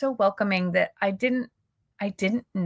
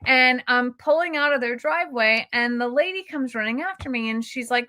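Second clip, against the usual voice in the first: ordinary talk, nothing marked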